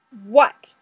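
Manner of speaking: loud sharp demanding what. A narrator's reading. Fraser confronting the detective, demanding loudly. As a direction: snap the question out. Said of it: "Fraser demands".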